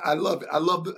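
normal talking voice

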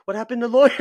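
high, very loud comic disbelief; mock outraged mom. Mom hearing about stand-up comedy plans, loudly dismayed. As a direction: cry out in mock dismay, close to laughing. Acting out mom's dismayed reply.

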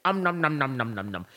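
playful nom nom eating sounds; mock chewing noises. Imitating Napoleon eating the lamb chop with mock nom noises. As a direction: smack through a pretend mouthful.

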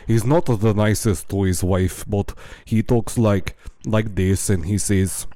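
imitated Moldovan accent. Telling co-host about a TV fiancé, in mock Moldovan accent. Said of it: "I'm doing this accent".

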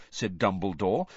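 plain reading voice

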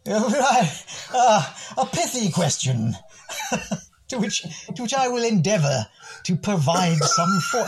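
a hint of a cut-glass English gent, pompous; hammed-up English accent. Guest putting on his most English accent for the host. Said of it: "reach into the depths of my most English accent".

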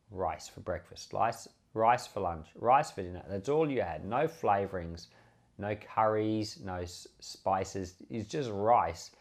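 ordinary delivery

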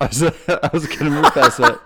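laughing through the words. Real, not acted. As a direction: protest while cracking up. Laughing while protesting teasing.

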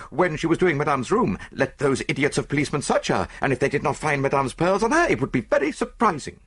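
French lady's maid protesting her innocence; indignant French maid. Acted. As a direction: pour it out in a rush of indignation.

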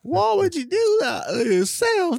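high squeaky mock outrage. Mock shocked reaction to friend.

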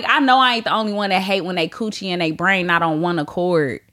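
ordinary talk, nothing marked

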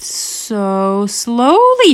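very slow, stretched words; drawn-out slowness. A narrator's reading. Teller showing the bug's crawl, drawn-out delivery. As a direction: stretch it out for suspense.

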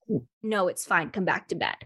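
low, restrained imitation of a partner; serious dismissal. Dream partner sending her back to bed, low and serious. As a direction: drop the pitch and close the matter. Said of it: "Like, very s".

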